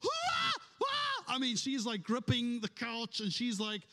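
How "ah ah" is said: high shrieking retold wail, loud; imitating his wife's loud high cry at the movie; mock wail. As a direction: shriek it like a sobbing wife at a sad film. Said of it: "she is like".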